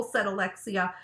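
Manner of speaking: ordinary delivery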